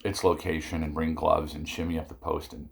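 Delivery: ordinary delivery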